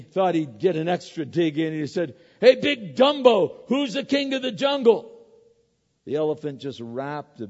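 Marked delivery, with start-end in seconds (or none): 2.4-5.1 Don't stress it: higher and louder, the lion bellowing his question; roaring lion. Acted. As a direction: boom it out like a proud lion. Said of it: "He let out a mighty roar".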